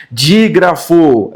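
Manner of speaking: drawn-out Dígrafo; slow term. Shown as a demonstration. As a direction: say Dígrafo slowly and clearly, as a new term. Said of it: "dígrafo é a junção de duas letras".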